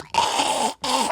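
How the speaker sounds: short strangled gasps; mock gasping for air. Pinned down by a huge agent, gasping for air. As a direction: gasp like a man pinned to the ground.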